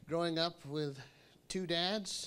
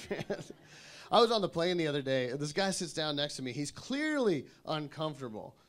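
normal talking voice

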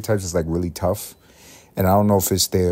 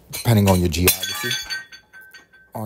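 ordinary delivery

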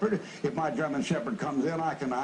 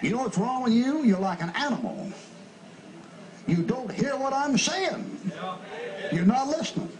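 raised, higher scolding preacher voice; preaching at full force; 9 semitones higher than usual. Acted. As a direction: drive it home to the pews, stretching "You don't hear".

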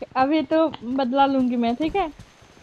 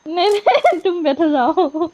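laughing refusal; 3 semitones higher, slower than usual. Real, not acted. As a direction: refuse playfully, laughing through it. Refuses to sit on fuel can. (हँसते हुए मना करना)

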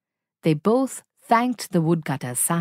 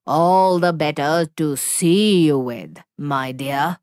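low, heavy wolf growl; wolf's growl; 4 semitones lower, 3 dB louder than usual. Acted. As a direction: growl it to scare her. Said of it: "growled the wolf".

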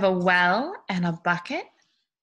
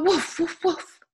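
high, playful barking; dog woofs; 9 semitones higher, 3 dB louder, slower than usual. Acted. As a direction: woof like a friendly dog for children. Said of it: "dogs go".

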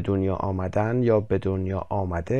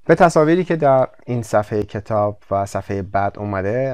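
ordinary talk, nothing marked

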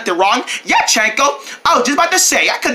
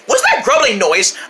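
ordinary delivery

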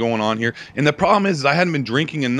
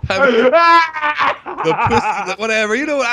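laughing shout; 12 semitones higher, 6 dB louder than usual. Real, not acted. Laughing shout after friend's joke.

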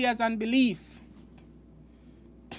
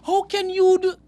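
grumbling worker; 7 semitones higher, 6 dB louder than usual. Acted. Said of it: "grumble and say".

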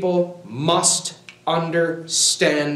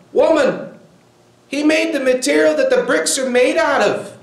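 much higher and louder, fervent preacher's cry; preaching climax; 10 semitones higher, 4 dB louder than usual. Real, not acted. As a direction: lift the voice into a fiery rebuke. Preacher rebukes the woman, climax.

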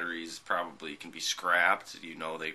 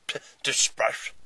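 spitting while talking; sputtering words with spit; spit take; 6 semitones higher, 8 dB louder, slower than usual. Real, not acted. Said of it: "I don't know why you spit all over".

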